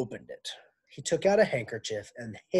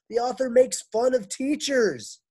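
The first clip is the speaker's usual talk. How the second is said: much higher and louder, exasperated; high, loud teacher's lament; 12 semitones higher, 10 dB louder than usual. Acted. Miss Zarves telling the men, high loud lament. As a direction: cry out the years of work. Complaining teacher voiced in story.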